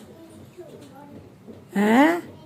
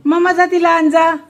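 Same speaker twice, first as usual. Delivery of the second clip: calling out sharply; 4 semitones higher, 6 dB louder than usual. Real, not acted. Mother scolding child leaning over.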